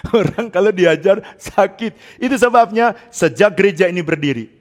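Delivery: normal talking voice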